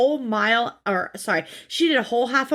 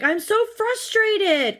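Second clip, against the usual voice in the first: whiny daughter; 10 semitones higher, slower than usual. Retold or imitated. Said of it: "She's like".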